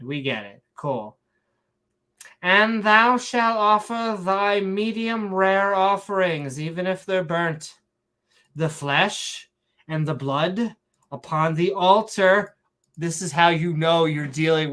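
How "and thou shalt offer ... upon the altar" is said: slow, declaimed scripture in fake Shakespearean; mock Shakespearean accent. Reading the law of offerings, grand mock Shakespearean accent. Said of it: "saying it in a Shakespearean accent".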